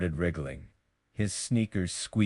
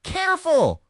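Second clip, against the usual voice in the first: much higher, playful teasing voice; teasing joke; 3 dB louder than usual. A narrator's reading. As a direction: rib him playfully. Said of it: "cafeteria staff joked".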